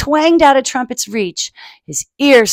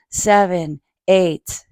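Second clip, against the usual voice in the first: ordinary delivery, nothing marked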